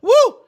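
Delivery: high loud woo of a worshipper; hammed-up high woo; mock church woo. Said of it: "and people, woo".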